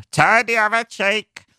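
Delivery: put-on high, rambling, doddering old man; old shambling man. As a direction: play a lost old man preaching kindness. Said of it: "just an old shambling man just going round going".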